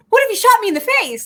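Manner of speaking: high, loud, fast panicky Harry mimicry; mimicking Harry. Quoting Harry to her co-host, high and fast. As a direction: squeak it out fast like a dim, alarmed Harry. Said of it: "And then Harry's like".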